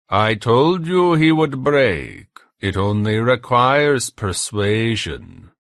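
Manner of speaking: slow, loud German tormentor with a smirk; German accent. A narrator's reading. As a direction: gloat slowly in a German accent. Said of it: "The accent was German".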